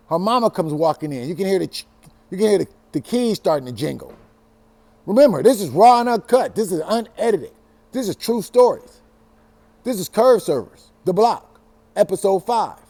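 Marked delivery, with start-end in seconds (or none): 5.1-12.8 punchy announcer aside, louder and higher; show intro. Acted. Host cutting into his own story with a loud show plug. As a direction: hype the show name like a host.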